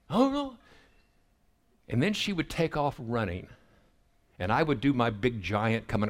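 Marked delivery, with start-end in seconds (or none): 0.1-0.6 very high squealed oh no; little girl squeal. Retold or imitated. Toddler fleeing her dad, squealing high.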